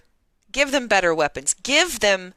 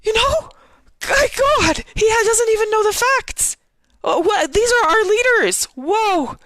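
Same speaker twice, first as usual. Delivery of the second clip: furious rant; 14 semitones higher than usual. Real, not acted. Streamer venting at the UN chief, high furious shouting. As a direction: rage at the official, voice cracking up high. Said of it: "I'll calm down".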